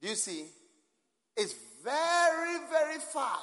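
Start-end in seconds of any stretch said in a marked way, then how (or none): 1.4-3.4 high, slow stretched "very far"; drawn-out exclamation. Real, not acted. Pointing at a far place on map.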